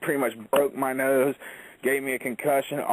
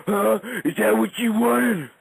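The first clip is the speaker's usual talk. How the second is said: loud, higher angry yell at the wrestler; 8 semitones higher, 4 dB louder than usual. Retold or imitated. Bull yells at the bloodied wrestler backstage, angry shouting. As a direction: bark it like a furious boss. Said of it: "all pissed off, yelling".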